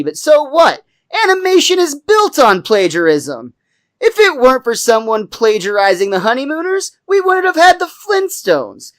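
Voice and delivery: high-pitched indignant self-justification; hammed-up courtroom protest; defensive rant. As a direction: argue your case, climbing with each example. Acted defensive courtroom rant about plagiarism.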